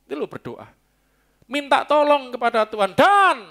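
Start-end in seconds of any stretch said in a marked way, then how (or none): 1.4-3.4 high, loud exhortation; preacher's cry. Real, not acted. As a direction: ring out the last word, long and loud. Preacher cries out, cueing the reading.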